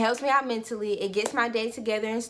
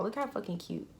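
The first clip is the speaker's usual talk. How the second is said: ordinary talk, nothing marked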